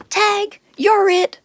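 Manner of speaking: high, loud and slow tag call; playful goat. A narrator's reading. Goat playing tag calls out, high and loud. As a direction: sing out the tag, teasing.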